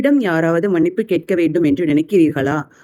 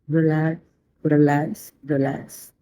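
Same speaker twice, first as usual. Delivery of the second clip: spa whisper; 4 dB quieter than usual. Acted. Closing relax cue, fading.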